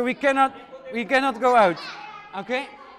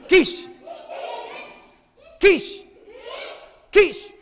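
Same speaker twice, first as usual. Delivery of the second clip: shouted high superhero cry; repeating louder and sillier; 13 semitones higher, 5 dB louder, much slower than usual. Acted. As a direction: belt it out louder each time. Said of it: "One more time, louder".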